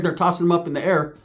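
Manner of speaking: ordinary delivery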